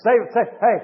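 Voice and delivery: ordinary talk, nothing marked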